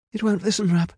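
high cry of rising worry; anxious cry. A narrator's reading. Medea cannot control the dragon, crying out anxiously. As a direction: cry out to your sister for help. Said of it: "she cried".

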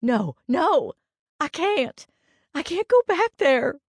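high, loud, panicked refusal; tearful, high-pitched protest; crying out. A narrator's reading. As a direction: cry it out in hysterical fear. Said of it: "Laura cried".

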